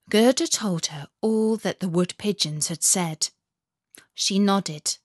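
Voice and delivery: plain reading voice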